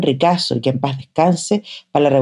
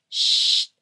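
whispered shush; hushing shh; 6 dB quieter than usual. A narrator's reading. Mother hushing the children in the corridor. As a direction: hush them with a finger to the lips. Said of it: "dijo la mamá Elisa ... llevándose un dedo a los labios".

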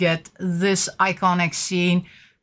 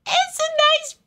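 much higher, slower teasing voice; mock coy giggle voice; cheeky squeak. Real, not acted. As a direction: say it high and silly. Cheeky joke about figure.

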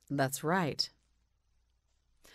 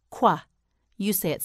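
normal talking voice

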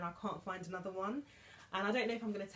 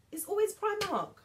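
exclaimed; 13 semitones higher, 7 dB louder than usual. Real, not acted. Friends always answer Primark.

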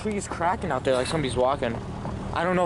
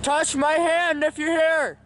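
slow, high raised voice; talking to a ghost; 10 semitones higher, 4 dB louder, slower than usual. Real, not acted. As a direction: plead slowly with the spirit. Addressing spirits.

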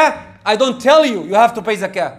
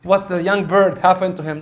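ordinary talk, nothing marked

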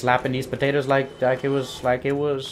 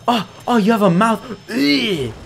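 1 grossed-out squeal; 10 semitones higher, 8 dB louder than usual. Real, not acted. Grossed out by monster.

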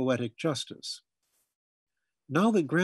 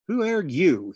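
put-on loud, higher gruff old-timer's challenge; demanding old man; 5 semitones higher, 6 dB louder than usual. A narrator's reading. Grandfather challenging the intruder, loud and gruff. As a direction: demand it like a suspicious old patriarch. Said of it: "he demanded in his deep, resonant voice".

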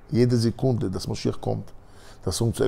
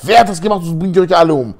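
higher and louder menacing yell; threatening shout; 7 semitones higher, 7 dB louder than usual. Acted. Guard after slamming his club, threatening shout. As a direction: threaten them all at full volume.

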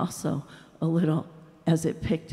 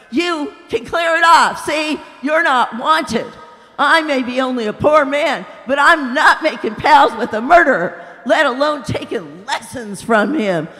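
loud, higher scornful shout; angry dismissal; 5 semitones higher, 7 dB louder than usual. A narrator's reading. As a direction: send him packing with scorn. Ghost drives him away.

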